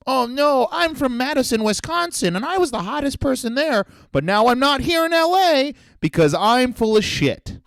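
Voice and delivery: high, whiny put-on voice of a vain transplant; mock the hometown hot shot who flopped in LA; high whiny caricature voice; whiny mock newcomer. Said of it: "the year where you decide".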